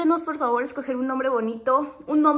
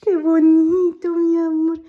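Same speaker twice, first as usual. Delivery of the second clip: loud adoring coo; 4 semitones higher, 10 dB louder, slower than usual. Real, not acted. Cooing at toddler with puppy.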